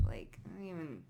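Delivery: whisper. Real, not acted. Tarot reader to viewers, whispering in disgust. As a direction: drop to a breathy whisper of disgust.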